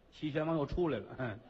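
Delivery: usual speaking voice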